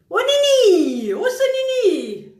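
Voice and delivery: high, loud, slow delighted cry; gushing exclamation. Real, not acted. Vlogger gushing at her friend Nini, loud and high. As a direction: stretch it out, squealing at her friend.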